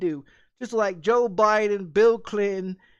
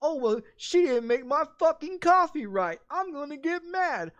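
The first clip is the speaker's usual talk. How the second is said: higher whiny complainer voice; mock whiner; 7 semitones higher than usual. Mocking people who complain about coffee, higher whiny voice. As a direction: mimic a spoiled customer, a bit higher. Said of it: "they bitch and complain about".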